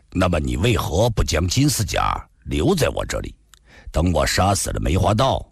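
measured, low dialogue voice; composed male hero, proposing. Acted.

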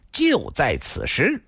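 slow, emphatic proclamation with a sinister laugh; stern, severe. Acted. Thin man announcing the hour and place, slow and stern. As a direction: drag out each phrase, stern and menacing. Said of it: "厉声地说道".

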